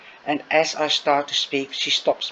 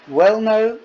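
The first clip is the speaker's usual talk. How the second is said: high, louder, caught off guard; flustered burst; 7 semitones higher, 7 dB louder than usual. Acted. Rue flustered by his friends' question, loud blurted answer.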